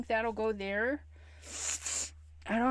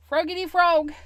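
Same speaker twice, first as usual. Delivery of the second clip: higher, louder playful voice; cutesy call; 6 semitones higher, 8 dB louder than usual. Pretend. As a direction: say it like a pet name. Cute name for stamp.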